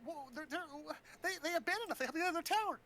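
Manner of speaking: high-pitched, flustered put-on protest; mock indignation. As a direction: protest in a squeaky-high hammed-up voice. Acting out characters' indignant quarrel.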